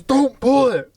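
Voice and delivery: high, sharp imitated warning; in-your-face mock anger. Attendant right in customer's face, mock angry warning. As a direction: confront the customer, acted in retelling. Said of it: "he fucking gets in my face".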